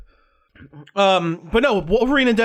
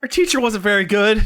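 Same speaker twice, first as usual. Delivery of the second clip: normal talking voice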